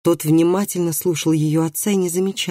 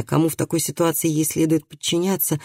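ordinary delivery, nothing marked

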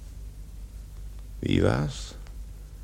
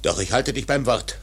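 louder, higher demand; rising anger; 6 semitones higher, 4 dB louder than usual. Acted. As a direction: fling the question at fate. Ahab raging at the prophecy.

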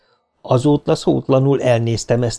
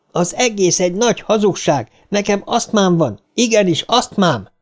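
higher, insistent cry; outburst; 7 semitones higher than usual. A narrator's reading. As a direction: protest with all his breath. Eddie's outburst.

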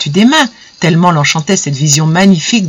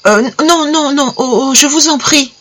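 high, pleading moan; a frightened high plea; 7 semitones higher than usual. A narrator's reading. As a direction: beg him to stop, trembling. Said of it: "gémit".

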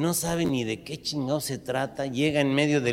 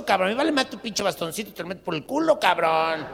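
put-on a young punk yelling abuse at an old man on the metro; punk's high yelling voice; 8 semitones higher than usual. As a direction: screech the insults at him, fast in places. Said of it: "clarísimamente dijo".

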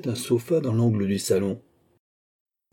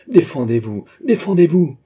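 loud demand, climbing higher on the repeat; urgent challenge; 6 semitones higher, 7 dB louder than usual. A narrator's reading. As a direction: challenge him, building to a high cry. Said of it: "reprit Philippe". (défi pressant, de plus en plus haut et fort)

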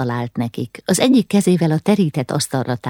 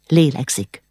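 plain reading voice

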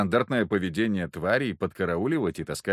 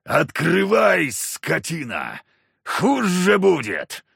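slow, high, booming monster voice; eldritch horror; 7 semitones higher, 6 dB louder, much slower than usual. A narrator's reading. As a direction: roar it slowly like a beast. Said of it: "нечеловеческий голос произнёс".

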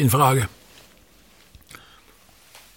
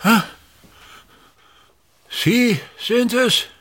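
startled; 10 semitones higher, slower than usual. Acted. Startled by the innkeeper.

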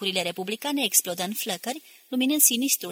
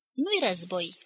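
ordinary delivery, nothing marked

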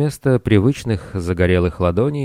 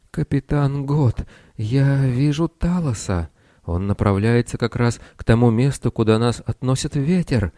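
lightly voiced frightened little wizard; a hint of a timid, plaintive squeak; 5 semitones higher than usual. A narrator's reading. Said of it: "жалобно пропищал он".

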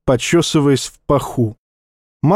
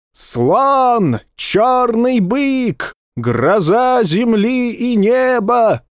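high, slow drawn-out herald's cry; town crier; 9 semitones higher, much slower than usual. Acted. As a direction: proclaim it slowly through a tin horn. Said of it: "орал тщедушный, тощий как глист, глашатай".

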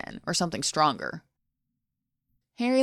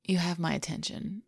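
ordinary delivery

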